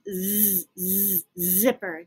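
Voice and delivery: slow buzzing "z" then zipper; sounding out Z. Shown as a demonstration. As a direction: model the z sound. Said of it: "Z says zipper".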